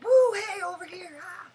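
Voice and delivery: shrieking high and loud across the street; rooftop yell. Retold or imitated. Calling out to a girl on a distant rooftop, shrieking. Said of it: "I was like".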